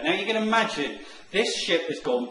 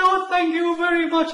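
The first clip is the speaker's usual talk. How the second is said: very high, louder tearful relief; tearful thanks; 14 semitones higher, 6 dB louder than usual. Retold or imitated. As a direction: gush thanks through sobs of relief. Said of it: "he burst into tears".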